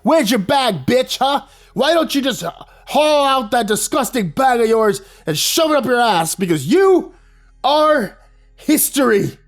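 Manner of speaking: put-on high, loud boy ranting; furious yell. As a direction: spit every insult at him, dragging out the last words slowly. Scotty yelling insults at principal.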